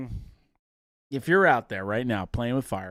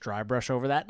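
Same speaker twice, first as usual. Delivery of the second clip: normal talking voice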